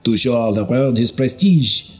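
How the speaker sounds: king mocking the bishop at dinner. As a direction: tease the bishop before the court. Karl mocks the bishop at feast.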